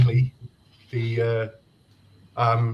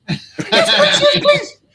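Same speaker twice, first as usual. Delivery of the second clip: put-on high-pitched pleading girlfriend; 6 dB louder, much slower than usual. Girlfriend begs him to kill it.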